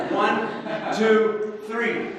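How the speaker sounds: loud, high count to three; calling a count-in. Real, not acted. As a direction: count the crowd in with rising energy. Counting the audience in for noises.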